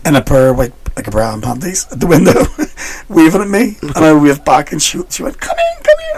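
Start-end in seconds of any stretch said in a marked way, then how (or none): 5.4-6.2 very high put-on woman's voice; beckoning prostitute. Prostitute at a window luring a passer-by, very high mock woman's voice. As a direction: coax him inside.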